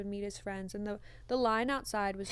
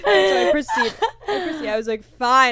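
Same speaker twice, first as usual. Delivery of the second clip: laughing; 6 semitones higher, 15 dB louder than usual. Real, not acted. Laughing through the retelling.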